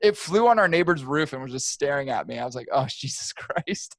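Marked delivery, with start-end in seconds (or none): 2.7-4.0 slow, chuckling oh Jesus Christ; laughing disbelief. Retold or imitated. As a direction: laugh it out, amazed. Bird staring at him.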